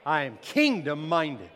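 loud, emphatic declaration; preacher's forceful rallying cry; like you mean it. Shown as a demonstration. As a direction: declare it with full conviction. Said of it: "say it like you mean it".

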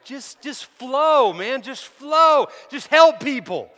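loud, high-pitched, impassioned preaching; full-force preaching. Real, not acted. Preacher defending a simple prayer, loud and fervent. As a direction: drive it home to the congregation at full volume.

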